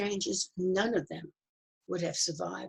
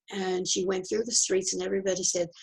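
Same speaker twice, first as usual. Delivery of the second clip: ordinary delivery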